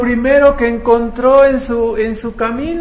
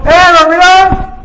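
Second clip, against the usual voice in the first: much higher and louder shout, drawn out; shouted wake-up call; 9 semitones higher, 11 dB louder than usual. Retold or imitated. Runner shouting at the sleeping pilgrim to wake up, loud call. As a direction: yell to wake a sleeper on the road. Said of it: "le gritó".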